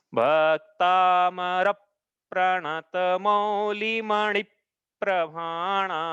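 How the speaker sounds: Bhaktamar प्रणत मौलि मणि प्रभाणा very slow, every long vowel held; correct verse reading. Shown as a demonstration. Teacher showing how the verse is read, long drawn syllables. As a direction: draw out Bhaktamar and each following word like a chant. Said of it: "ऐसा पढ़ना है".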